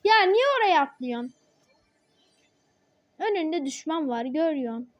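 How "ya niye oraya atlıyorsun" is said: annoyed outburst. Real, not acted. Teammate jumps the wrong way.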